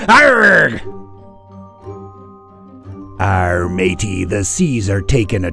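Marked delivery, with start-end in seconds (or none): 0.1-0.8 high, loud pirate growl; pirate arrgh. Acted. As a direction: let out a big pirate roar. Pirate cry.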